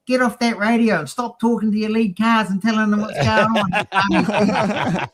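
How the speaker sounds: high, slow, drawn-out retold scolding; hammed-up scolding, pitch held high; mock telling-off. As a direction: order him off in playful exasperation. Retold telling-off of Graham over radio.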